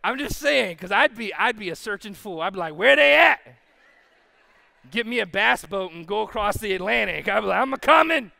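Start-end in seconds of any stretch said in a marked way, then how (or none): 2.7-3.4 loud, high comic holler; playful search-party yell; searcher calling out. Acted. Acts searching pastor calling out. 7.6-8.3 loud, high comic holler; playful hollered promise; rescuer calling out. Acted.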